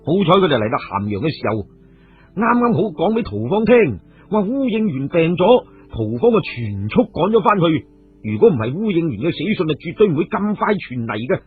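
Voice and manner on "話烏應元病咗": ordinary delivery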